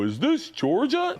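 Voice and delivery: high, drawn-out playful Santa question; slow, rising call to a child; imitating Santa. Uncle as Santa greets the girl on the phone, high and singsong. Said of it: "he'd be a perfect Santa voice".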